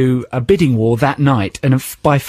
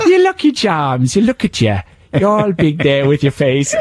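leprechaun. Retold or imitated. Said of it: "You made him sound like a leprechaun".

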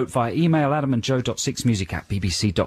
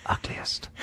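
barely audible, conspiratorial whisper; secretive whisper; 10 dB quieter than usual. Retold or imitated. Invigilating teacher to a colleague during the exam, secretive whisper. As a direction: whisper it under your breath.

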